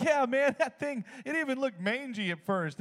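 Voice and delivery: high-pitched, merry storyteller; jovial friend. Retold or imitated. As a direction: imitate a buddy tickled by his own tale. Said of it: "He's very jovial".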